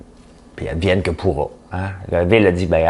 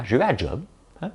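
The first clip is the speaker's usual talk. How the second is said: ordinary talk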